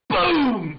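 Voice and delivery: loud, high shouted boom sound; shotgun boom. Retold or imitated. Firing the shotgun at the enemy, loud shouted boom. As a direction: blast it out like a gunshot.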